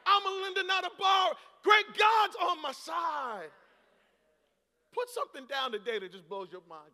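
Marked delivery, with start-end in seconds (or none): 0.1-3.5 loud, rising confession; bold declaration. Acted. Pastor modelling a confession for the church, shouting. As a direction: declare it with faith. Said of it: "stand up and say".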